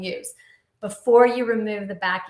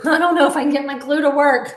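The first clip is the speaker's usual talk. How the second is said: louder, fast, laughing at the stuck glue; laughing frustration; 4 semitones higher, 8 dB louder, faster than usual. Real, not acted. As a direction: laugh it off while fighting the bottle. Glue bottle won't squeeze.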